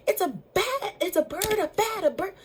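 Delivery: high, tumbling panicked words; panicked babble. Retold or imitated. Telling sister what flew at her, panicked. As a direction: blurt it out in a fright. Panicking over flying animal.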